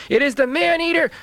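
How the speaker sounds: high, loud squeaky cry; frightened crayfish squeaking high. A narrator's reading. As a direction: squeak in terror like a tiny crayfish. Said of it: "squeaked the crayfish".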